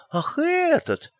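high, slow oh this one; feigned surprise. A narrator's reading. Magrat pretending she forgot the axe she waves. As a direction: act as if just noticing.

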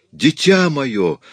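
high, loud, drawn-out cry; exclaiming. A narrator's reading. Mother cries out to her materialised child, exclaiming. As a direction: cry out slowly with overwhelming emotion. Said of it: "воскликнула мадам Икс".

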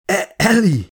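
high, stammered call. A narrator's reading. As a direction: call to her, tripping on the name. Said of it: "kiáltott Holloway is Elizabeth felé". (akadozó, magas hangú kiáltás)

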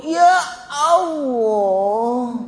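long wailing groan, very stretched; mock pained groan. Sufferer with a boil trying to sit, hammed-up groan. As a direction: drag each word out as if it hurts. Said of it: "Coba kalau diuji sakit".

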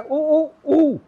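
high rooster-like उ, उ, उ; rooster call. Shown as a demonstration. Teacher imitating a rooster's crow for students. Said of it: "मुर्गा जैसे बोलता है".

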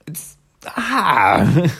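high, loud, anguished groan; exasperated groan. Real, not acted. Host upset at parents rejecting their child, exasperated. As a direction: let out a frustrated wail.

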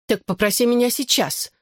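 high-pitched, mildly imperious demand. A narrator's reading. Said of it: "требовательным тоном произнесла она". (требовательно, высоким голосом)

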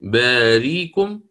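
باريكم read slowly with the hamza turned into ya; hamza replaced. Shown as a demonstration. Teacher showing the replaced hamza. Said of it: "عنده إبدال".

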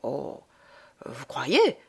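whispered, uneasy doubt; worried whisper. A narrator's reading. Tancré, wary of Madame Bondel, whispers his worried doubt. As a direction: breathe the doubt out, afraid of trouble. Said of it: "parut inquiet".

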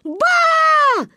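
put-on high, loud child's yell; panicked call. (зовущий крик, громко и высоко)

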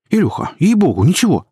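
high-pitched, anxious-to-convince jabber; jabbering sidekick, pitched well up. A narrator's reading. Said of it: "затараторил дружок".

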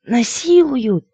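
high, drawn-out squeal; squeak. A narrator's reading. Crow groped in the dark closet, squeaking. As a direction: squeal for help, long and high. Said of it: "Ворона пискнула".

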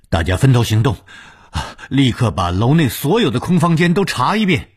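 high, urgent shouted command; shouting orders. A narrator's reading. Ordering everyone to search the villa, urgent shout. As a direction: shout it out to everyone, pitch climbing. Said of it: "便大叫着说".

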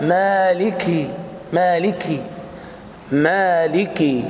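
very slow مالكي with a hard, stopped kaf; firm kaf. Shown as a demonstration. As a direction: stress the plosive kaf of مالكي. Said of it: "بس شوف الكاف".

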